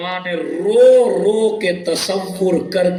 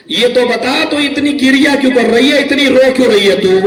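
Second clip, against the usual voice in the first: loud pleading question; 6 semitones higher, 6 dB louder than usual. Retold or imitated. Parents question weeping daughter.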